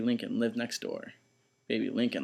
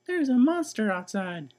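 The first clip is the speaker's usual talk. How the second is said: 1 scared little sister; 10 semitones higher, 5 dB louder than usual. Acted. Baby voice.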